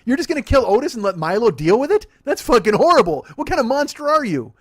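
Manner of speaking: loud, high, laughing mock indignation; mock outrage. To his cohost about Milo and Otis, loud mock outrage.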